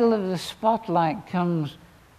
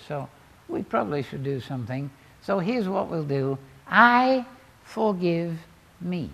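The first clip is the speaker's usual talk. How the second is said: ordinary talk, nothing marked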